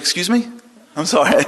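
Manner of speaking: high-pitched taken-aback query; incredulous. Retold or imitated. Woman hearing the rabbi's odd advice, incredulous. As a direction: react as if you misheard.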